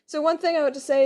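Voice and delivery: ordinary talk, nothing marked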